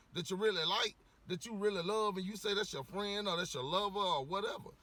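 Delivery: ordinary delivery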